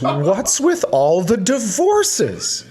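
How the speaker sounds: high, slow, mock-weepy wail; mock crying. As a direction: wail it like a teary cashier, played for laughs. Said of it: "employee starts crying and she goes".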